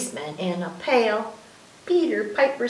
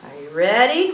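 ordinary talk

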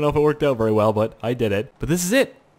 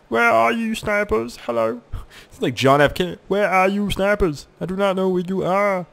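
higher teasing put-on voice; mock calling snipers; 7 semitones higher than usual. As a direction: taunt the hidden snipers lightly. Calls to snipers in voice.

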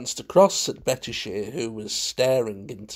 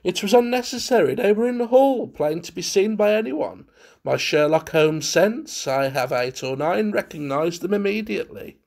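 high, brisk, self-satisfied; lofty; 6 semitones higher, 3 dB louder than usual. A narrator's reading. Valentine boasting of his Sherlock Holmes sense, loftily. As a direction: explain it airily from a great height. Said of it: "said loftily".

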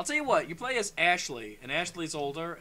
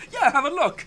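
high, loud acted reply; imitated camp counselor; 10 semitones higher, 13 dB louder than usual. Counselor reassuring the nurse about the kids, imitated. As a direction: mimic the counselor, pitched up and loud. Counselor answers in retold scene.

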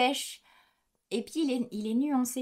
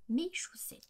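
whisper; 6 semitones higher, 9 dB quieter than usual. Real, not acted. Dreaded topic, whispered.

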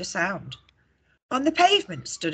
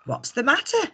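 George asking; 8 semitones higher than usual. A narrator's reading. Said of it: "asked George".

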